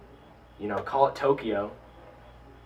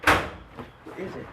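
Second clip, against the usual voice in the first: scared whisper; 8 semitones higher, much slower than usual. Real, not acted. Sudden fright at something unseen.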